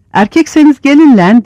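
high, loud dare; taunting shout. A narrator's reading. Tantana Yusuf dares the rightists with a high, loud shout. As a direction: shout the challenge from the top bunk. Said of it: "diye bağırdı".

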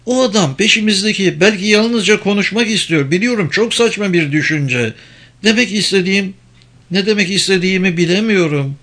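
high-pitched woman's role; Penelope, speculating. Acted. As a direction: lift the pitch, working out a theory. Voices Penelope thinking aloud.

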